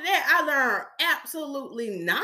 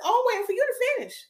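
high, quick, sing-song reply; faux-sweet high-pitched answer, rattled off quickly; mock innocence; 11 semitones higher, faster than usual. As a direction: act innocently patient, pitched way up. Feigned innocence to check a client.

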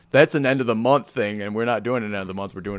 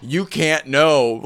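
slow scolding; 3 semitones higher, 3 dB louder, much slower than usual. Retold or imitated.

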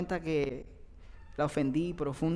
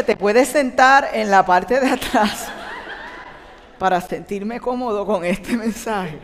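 shouting at his wife, much louder and slow; angry shout; 3 semitones higher, 9 dB louder than usual. Retold or imitated. A husband yelling at his wife while driving, angry and loud. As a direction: bark it out with a bitter laugh. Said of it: "le dije".

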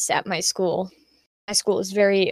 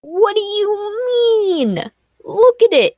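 much higher, slow, stretched-out amazement; incredulous disbelief; 14 semitones higher, 4 dB louder, slower than usual. Retold or imitated. Reacting to a friend calling her drawing awful, high and slow in disbelief. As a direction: stretch it out in wide-eyed disbelief. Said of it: "And I'm like".

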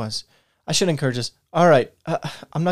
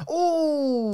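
high ooh; 12 semitones higher than usual. Acted. Reacting to bad news.

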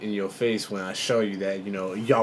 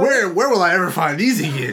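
excited; 8 semitones higher, 8 dB louder than usual. Retold or imitated.

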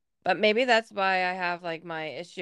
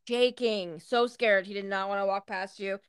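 usual speaking voice

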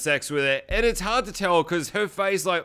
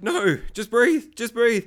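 imitated desperate urging; 7 semitones higher, 3 dB louder, slower than usual. Voices Dean urging dying Claire.

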